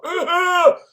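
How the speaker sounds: sudden high loud yelp; startled loud cry of disgust; shocked yelp. Retold or imitated. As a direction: yelp at the taste of smoke. First kiss with smoker.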